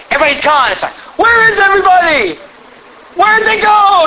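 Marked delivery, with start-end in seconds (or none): 1.2-4.0 high, loud mock-bewildered bystander; mock panic. Preacher mocking raptured bystanders' panic, high and loud. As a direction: play a confused person after the rapture, mockingly.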